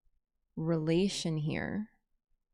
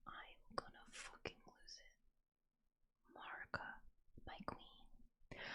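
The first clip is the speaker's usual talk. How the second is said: barely voiced, softer whisper; breathless whisper; 28 dB quieter than usual. Real, not acted. Fan overwhelmed by her favourite goddess, whispering. Overwhelmed aside during reading.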